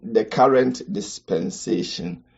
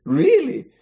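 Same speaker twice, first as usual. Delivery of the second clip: much higher, incredulous "really"; mock surprise. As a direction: feign disbelief. Reacting to own survey ranking.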